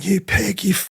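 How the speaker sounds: jeering prisoners. Retold or imitated. Prisoners on the landings taunting him, jeering threats. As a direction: taunt the new cop from the landings. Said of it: "they're all on the landings going".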